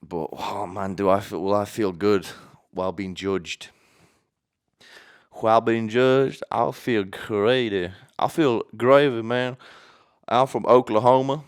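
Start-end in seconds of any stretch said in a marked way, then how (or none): 5.3-11.4 slow hammed-up Oklahoma twang, louder; mock Oklahoma drawl. Hat-wearer playing Oklahoma cowboy to viewers, hammed-up Oklahoma drawl. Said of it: "talking like this".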